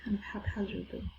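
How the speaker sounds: soft unvoiced whisper; hushed whisper off-mic. Real, not acted. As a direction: whisper it as an aside. Whispered aside before reading.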